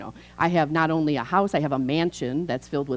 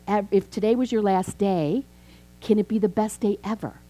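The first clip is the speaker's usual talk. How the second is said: ordinary talk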